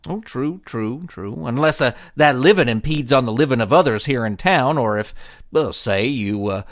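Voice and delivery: wry lawman deputy. Acted. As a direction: let the threat land slowly on the last words. Deputy warns gang about bank.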